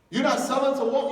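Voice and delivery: as if the refusal is absurd; incredulous echo. Retold or imitated. Echoing the refusal in disbelief.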